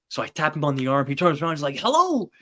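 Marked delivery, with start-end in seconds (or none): 1.8-2.3 imitating a surprised hello, pitched up and louder; a chirpy, lifted hello from the retold magician; mock greeting. Magician turning to greet the kid fan, with a bright mock hello.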